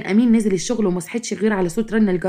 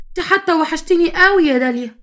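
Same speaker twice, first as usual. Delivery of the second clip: high-pitched gushing; lifted, drawn-in sing-song endearment; gushing affection; 7 semitones higher than usual. A narrator's reading. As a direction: coax with exaggerated fondness. Sister-in-law coaxing Dalia to join.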